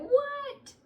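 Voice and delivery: very high, hammed-up child protest; imitating kids balking at mopping, very high; shocked kids. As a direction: squeal like a kid refusing chores. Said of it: "And they'd be like".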